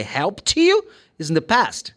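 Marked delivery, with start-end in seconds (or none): 0.0-0.8 helped you run together; linked helped you. Shown as a demonstration. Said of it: "But if I say".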